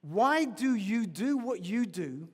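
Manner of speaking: slow and deliberate, word by word, for note-taking; slow dictation. Shown as a demonstration. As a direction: give them time to write each word. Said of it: "Write this down for me, please, this question".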